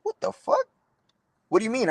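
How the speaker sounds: high-pitched, incredulous retold reaction; shocked disbelief. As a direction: re-enact disbelief at terrible news, pitch jumping up. Retelling shock at father's death news.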